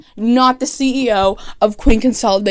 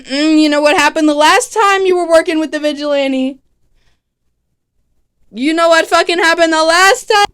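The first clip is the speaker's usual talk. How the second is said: hammed-up scolding, raised pitch and loud; mock outrage; 5 semitones higher, 5 dB louder than usual. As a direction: build to an over-the-top indignant yell. Acted angry confrontation, repeated louder.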